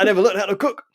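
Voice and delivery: put-on light Geordie accent, high and loud; dialed-down Geordie punchline. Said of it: "that was a Geordie accent".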